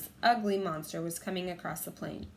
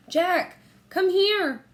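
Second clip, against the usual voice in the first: loud, much higher call; 11 semitones higher, 10 dB louder, much slower than usual. A narrator's reading. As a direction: call him up the hill. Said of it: "Annie called".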